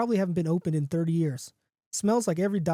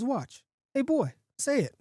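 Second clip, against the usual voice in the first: higher voice calling to a dog; coaxing a dog; 8 semitones higher, slower than usual. Acted. John showing off the wolf to his friend, coaxing. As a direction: coax the animal to perform.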